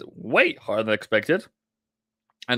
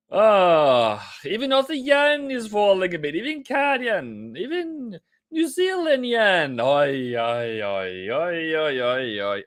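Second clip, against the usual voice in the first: high wail sinking into oi-oi-oi; mock lament; 3 semitones higher than usual. Trader listing each falling yen pair, mock lament. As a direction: whine it in playful, hammed-up dismay.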